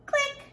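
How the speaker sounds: high clicking sound; playful mouth click; camera click. Pretend. Pretend camera shutter noise.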